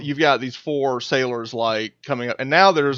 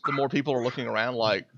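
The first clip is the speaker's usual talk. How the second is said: ordinary delivery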